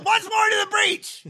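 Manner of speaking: booming stage bellow; Brian Blessed bellow. Retold or imitated. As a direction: roar it like a Shakespearean actor. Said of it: "Channel Brian Blessed".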